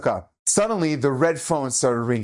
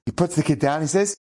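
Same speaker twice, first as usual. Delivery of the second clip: ordinary talk, nothing marked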